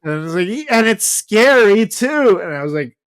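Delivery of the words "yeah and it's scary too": higher, slower, louder mock-child whine; whiny little kid. Friend mimics the brother's scared complaint, in a high slow whine. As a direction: plead like a small scared boy.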